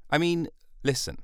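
ordinary delivery

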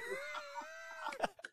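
real laugh at the crazy story; big laugh. Real, not acted.

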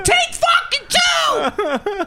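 very high, loud and slow; mock screamed command; slow furious shout. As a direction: hammer each word. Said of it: "I go".

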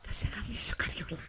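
echoing her friend's joke threat, whispered; mock whisper. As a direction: whisper it as a playful menace.

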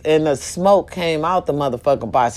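ordinary talk

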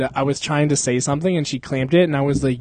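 ordinary talk

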